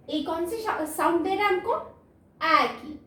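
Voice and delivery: normal talking voice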